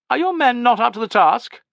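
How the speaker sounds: high, loud goading question; needling. A narrator's reading. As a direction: taunt them about being up to it. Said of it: "needled Tubero".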